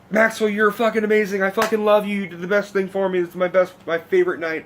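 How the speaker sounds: high-pitched, effusive thanks to a friend; excited run-on praise, pitch easing down; retold gushing. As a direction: imitate yourself gushing over the best night.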